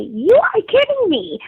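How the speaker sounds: high, loud incredulous cry; can't believe she's being arrested; shocked disbelief. Retold or imitated. Told she's under arrest for braiding, high shocked disbelief.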